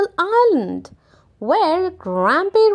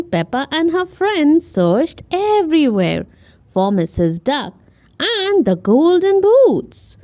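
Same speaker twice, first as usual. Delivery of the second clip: ordinary delivery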